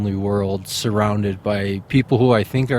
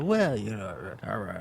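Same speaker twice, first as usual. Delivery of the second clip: lame excuse; 10 dB quieter than usual. Retold or imitated.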